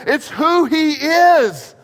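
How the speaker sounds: high, loud and drawn out; preaching at full force; emphatic climax. Real, not acted. Preacher climaxing main point.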